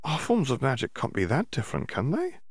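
lightly voiced eager schoolgirl, probing; curious Hermione. A narrator's reading. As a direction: Hermione haltingly asking the house elf a question. Hermione questioning.

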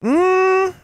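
high, held schoolyard hum; drawn-out tattletale "mmm" on a high note; intoned "mmm". A narrator's reading. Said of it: "intoned Sylvia".

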